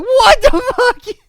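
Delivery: very high, loud, drawn-out disbelief; squealing what the fuck, slowed right down; incredulous outburst. Retold or imitated. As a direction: replay the shocked yelp. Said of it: "I laughed out. I'm like".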